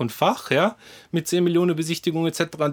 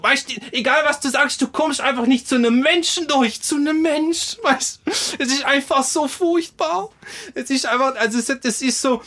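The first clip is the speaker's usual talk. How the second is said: rant, pitched way up, pleading; exasperated rant; 9 semitones higher than usual. Real, not acted. Exasperated rant about hotline.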